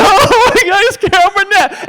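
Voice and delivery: overwhelmed sobbing squeal at her hero; tearful squeal. Retold or imitated. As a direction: gush like a starstruck fan who's crying. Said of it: "kind of an ugly cry".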